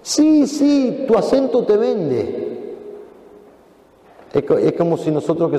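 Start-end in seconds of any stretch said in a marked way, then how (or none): 0.0-2.4 high, slow taunt; mocking accuser. Accuser taunting Peter about his accent, high.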